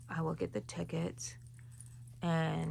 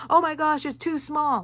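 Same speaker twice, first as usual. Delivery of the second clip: high, loud mock panic over a small piece; hammed-up alarm at her own crochet; panicked "too small"; 9 semitones higher, 10 dB louder than usual. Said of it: "I have a bad habit of saying".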